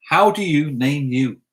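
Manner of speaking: word by word, very slow; slow literal translation. Shown as a demonstration. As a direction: spell out the translation slowly. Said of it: "That's how you say it".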